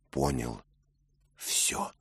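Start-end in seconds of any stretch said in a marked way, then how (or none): none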